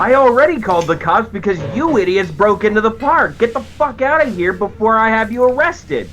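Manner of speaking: angry yelling back. Acted. Guard orders trespassers out under threat of arrest, angry yelling.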